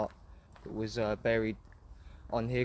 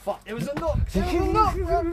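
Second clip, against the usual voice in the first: high, loud panicked cry; shocked shout as the door knocks; real fright, voice jumping high and loud; frightened yelp; 8 dB louder than usual. Real, not acted.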